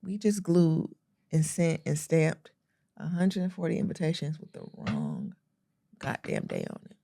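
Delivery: deflated disbelief. Retold or imitated. Telling how they sent the wrong date, soft and sinking. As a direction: let it drain out in dismay. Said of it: "And I said".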